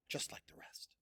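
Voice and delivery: soft whispered aside. A narrator's reading. Narrator whispering the aside to listeners. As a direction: breathe it out.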